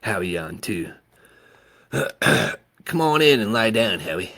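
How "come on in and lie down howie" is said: ordinary delivery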